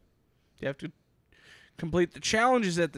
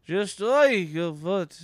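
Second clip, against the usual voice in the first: slow, lightly put-on Russian accent; 3 semitones higher, 6 dB louder, much slower than usual. Shown as a demonstration. Showing a friend one of his voices, a loose Russian accent. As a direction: stretch the words in a rough Russian accent. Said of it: "I did my Russian voice".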